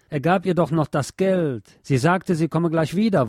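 ordinary delivery

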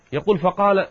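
ordinary delivery, nothing marked

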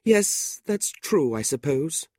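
high, plaintive widow giving in; reluctantly agreeing widow. A narrator's reading. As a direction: agree weakly in a high woman's voice. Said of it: "Missus Nickleby agreed".

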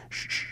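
very soft shh; quiet hissed shush. Acted. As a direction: hush the child quickly. Father hushing boy.

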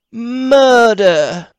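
"murder." high and loud, landed hard; decided emphasis. Acted. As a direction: deliver "murder." as a final verdict. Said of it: "bring out the word very decided".

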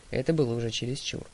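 ordinary delivery

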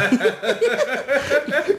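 mischievous kobold cackle; impish giggle. Acted. Kobold after mimicking the servant, gleeful giggle. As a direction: giggle at your own parody.

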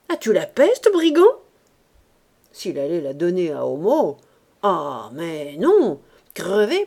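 theatrical tirade, often loud and swelling; grumbling Ursus, indignant. Acted. Hungry man rails at the one eating his supper, indignant. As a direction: rant in mock outrage at a greedy guest.

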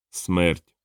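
low, solemn single word; low voice of doom; Death. A narrator's reading. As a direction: announce yourself as the Reaper, pitched low. Death answers the knock-knock joke.

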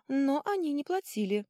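high, drawn sly hint; sly explanation. A narrator's reading. Girl hints to troll they never paid, high sly tone. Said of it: "блестя глазами, как дикая кошка".

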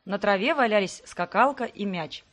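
plain reading voice

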